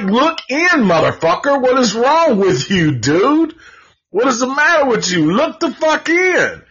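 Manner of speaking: high, exasperated yell at a clueless character; yelling at the screen. Retold or imitated. Viewer yelling at the dad on screen, high and exasperated. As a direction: shout it like he can't see what's behind him. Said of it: "I was like".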